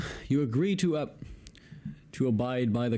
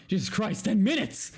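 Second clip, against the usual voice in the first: exasperated outburst; 9 semitones higher than usual. Real, not acted. Reader fed up with endless license text, exasperated cry. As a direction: throw up your hands, fed up.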